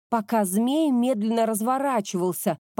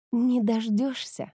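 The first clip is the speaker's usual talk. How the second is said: mocking whisper; 3 semitones higher, slower than usual. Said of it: "насмешливо шепнула она".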